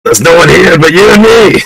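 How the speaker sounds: high spooky shout through the window; mock ghostly shout. Pranking friend shouts spooky taunt through window. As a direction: call out like a ghost to scare him. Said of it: "shouted in the window".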